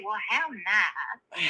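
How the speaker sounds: very slow, stretched-out polite brush-off; mock politeness. Shown as a demonstration. Caller advising host when flustered, drawn-out mock politeness. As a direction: drag it out like a belle. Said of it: "you just say".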